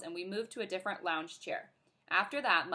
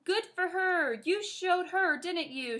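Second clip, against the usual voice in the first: louder cheering grandma, lightly voiced; grandma yelling; 9 semitones higher, 3 dB louder, slower than usual. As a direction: cheer the girl on across the pool. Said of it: "she yelled".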